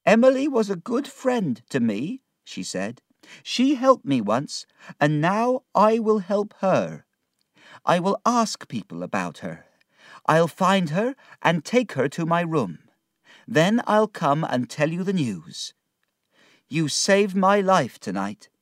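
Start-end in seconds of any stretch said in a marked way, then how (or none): 0.1-2.2 high-pitched, earnest character voice; Martha's high voice. Acted. Martha remembers her friend Emily, high earnest voice. 3.4-18.5 high-pitched, earnest character voice; earnest promise. Acted. Martha promises to find her friend Emily, earnest high voice.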